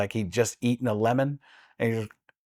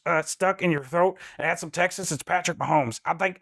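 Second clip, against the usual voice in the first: ordinary talk, nothing marked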